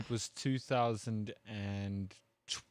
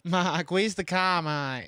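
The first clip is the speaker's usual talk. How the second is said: higher, louder Aussie voice, laughing; mock Australian accent; 6 semitones higher, 4 dB louder, slower than usual. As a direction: ham up a laughing Aussie calling out to Mark. Mocking Australians, loud laughing.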